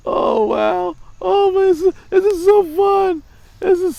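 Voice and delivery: laughing fit, voice up high and breaking; cracking up, barely getting words out; high squealing laugh. Real, not acted. Vlogger laughing while mocking Tom Brady.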